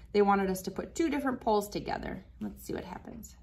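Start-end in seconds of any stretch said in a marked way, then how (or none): 2.4-3.4 whispered under the breath; hushed whisper. Real, not acted. Whispering to the child as the magnets meet, hushed. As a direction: hold your breath and whisper it.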